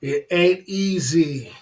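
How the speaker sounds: deliberate, slowed-down declaration; slow emphatic. Real, not acted. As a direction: lean on every word slowly. Second take, slow and louder.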